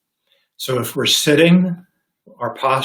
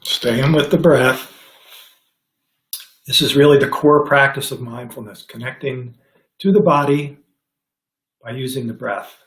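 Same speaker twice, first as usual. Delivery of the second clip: guided meditation. Acted. Teacher guiding the class into mindful breathing, calm meditation voice. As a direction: ease them into the breath gently.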